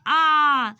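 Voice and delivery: आ held long and loud, mouth fully open; wide open आ, loud and sustained; vowel demo. Shown as a demonstration. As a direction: belt out an open आ. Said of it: "आप बोल के देख सकते हैं".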